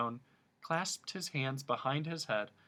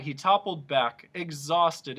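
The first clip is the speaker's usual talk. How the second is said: plain reading voice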